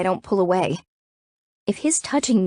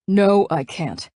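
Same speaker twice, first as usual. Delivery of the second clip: raspy bite; slower than usual. A narrator's reading. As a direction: bite the words out with a rasp. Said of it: "Nick bites out, his raspy voice".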